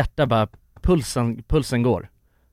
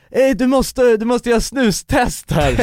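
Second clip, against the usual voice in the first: higher, slower cocky lad voice; higher cocky challenge; lad's challenge; 8 semitones higher, slower than usual. Retold or imitated. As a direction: dare him like a street lad. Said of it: "Och så säger han bara".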